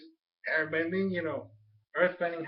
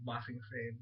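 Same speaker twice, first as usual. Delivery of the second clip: Ba Sing Se said much softer as an aside; quiet correction; 6 semitones lower, 11 dB quieter than usual. Shown as a demonstration. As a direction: mutter Ba Sing Se as a correcting afterthought. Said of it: "No, no, just kidding".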